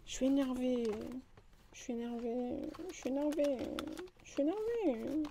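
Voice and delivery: soft, repeated grumble of annoyance; annoyed muttering. Real, not acted. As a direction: grumble it quietly, over and over.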